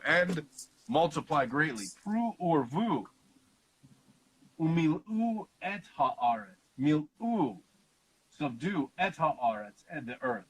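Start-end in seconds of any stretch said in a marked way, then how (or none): 2.1-7.6 slow, deliberate Hebrew chant; Hebrew recitation. Acted. Teacher recites the Hebrew verse for listeners, slow intoning.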